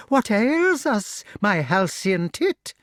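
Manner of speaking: witch voice. A narrator's reading. Witch figure speaks.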